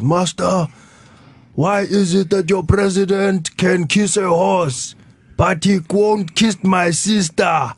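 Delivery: lightly voiced old man delivering a cheeky line; grandfather's joke. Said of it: "the policeman and he says".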